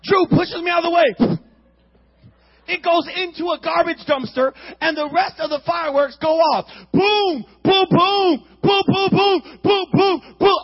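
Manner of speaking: high, loud, then boom boom; loud excited telling. Retold or imitated. Retelling the dumpster blowing up, loud excited booms. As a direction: build to the explosions.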